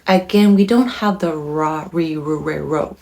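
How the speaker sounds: usual speaking voice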